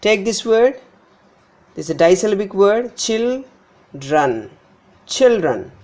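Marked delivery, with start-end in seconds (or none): 3.0-4.5 put-on children said slowly as CHIL...dren; first-syllable stress. Shown as a demonstration. Teacher showing noun stress, children slowly stressed on CHIL. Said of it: "So the stress lies here on the beginning syllable". 5.1-5.7 put-on children as CHIL-dren, first syllable punched; first-syllable stress. Shown as a demonstration. Teacher demonstrating noun stress, children stressed on CHIL. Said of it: "stress lies here on the beginning syllable".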